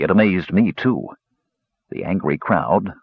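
ordinary delivery, nothing marked

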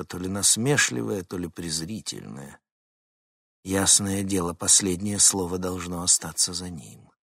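ordinary delivery, nothing marked

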